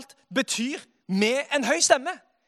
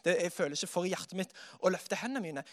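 doubting churchgoer; 7 semitones lower, 7 dB quieter, faster than usual. Retold or imitated. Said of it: "det er noen som tenker".

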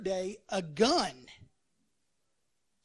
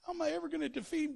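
mock worried soldier; 6 semitones higher than usual. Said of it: "some of those guys go".

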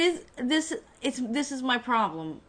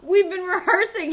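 mock high whine; 8 semitones higher, 6 dB louder than usual. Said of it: "everybody was like".

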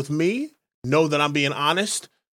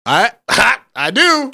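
a touch of a chuckle, higher and louder; laughing boast; 5 semitones higher, 6 dB louder, slower than usual. Retold or imitated. Bar regular answering a woman with a cocky laughing boast. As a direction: grin through a cocky reply.

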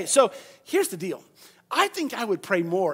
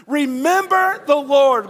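louder, higher, slow; said together; 5 semitones higher, 8 dB louder, slower than usual. Shown as a demonstration. Preacher leading the congregation to recite, loud and slow. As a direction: proclaim it with the crowd. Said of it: "Let's say that together".

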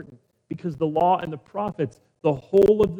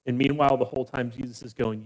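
ordinary talk, nothing marked

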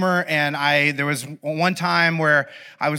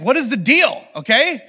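worked-up, higher-pitched mock desperation; paranoid; 6 semitones higher than usual. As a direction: demand answers like an insecure, rejected friend. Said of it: "I start getting, like, paranoid".

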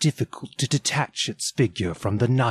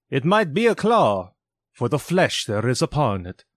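sorrowful reply; 4 semitones higher, 3 dB louder than usual. Acted. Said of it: "was the spirit's sorrowful reply".

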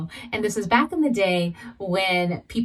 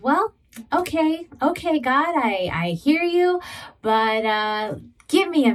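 mock hesitant pleading, higher; a nervous okay okay stalling God; telling God to wait; 5 semitones higher than usual. Speaker retelling her reply to God, higher and hesitant. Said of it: "And so I said".

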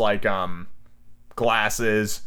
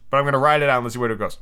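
ordinary delivery, nothing marked

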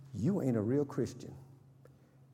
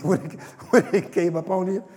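laughing through louder words; 6 semitones higher, 8 dB louder than usual. Real, not acted. Preacher laughing at cowardice, loud. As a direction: laugh as you say it.

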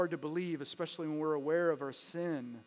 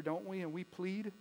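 ordinary talk

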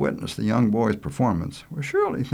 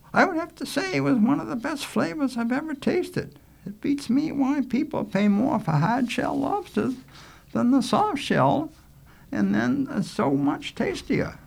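high-pitched, unhurried schoolteacher; appreciative Rose. Acted. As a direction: savour the praise in a high, ladylike voice. Rose praises the lobster.

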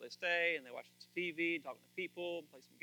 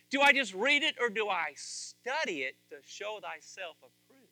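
louder and higher, slow and emphatic; preaching at full force; 5 semitones higher, 13 dB louder than usual. Acted. Pastor challenging his congregation about reading the Word, loud and emphatic. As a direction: press the question home to the church.

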